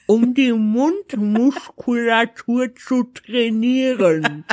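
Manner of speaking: talking around a cork; muffled speech with a cork held between teeth; cork in mouth. Real, not acted. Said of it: "das Ding schmeckt voll eklig".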